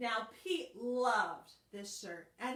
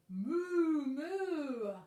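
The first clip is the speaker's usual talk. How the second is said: higher, loud mooing; cow moo; 5 semitones higher, 7 dB louder than usual. Acted. Teacher mooing as the cow in the song. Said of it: "The cow said".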